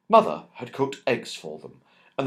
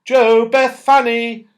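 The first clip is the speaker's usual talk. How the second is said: high, loud call across the garden; calling from afar; 11 semitones higher, 12 dB louder, slower than usual. A narrator's reading. Mother calling the children from the cottage, loud and high. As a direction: call the children home from a distance. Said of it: "came their mother's voice from the cottage".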